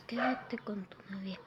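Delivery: breathy hushed aside; bitter whisper to him. Acted. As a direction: hiss it under the breath.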